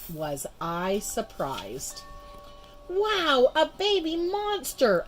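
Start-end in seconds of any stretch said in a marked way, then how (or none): 2.9-5.0 high, loud and slow amazed cry; excited yell. A narrator's reading. Girl finds a baby monster, high excited yell. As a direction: shout in wonder at the find. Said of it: "I yelled".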